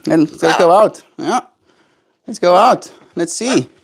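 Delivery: high sing-song pet talk. Acted. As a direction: coax a pet gently. Coaxing pet bird outside.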